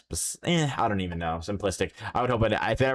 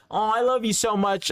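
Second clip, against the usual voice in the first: much higher, sweet mock mother; mom's gushing; 13 semitones higher than usual. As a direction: coo it like a doting mom. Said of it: "You're like".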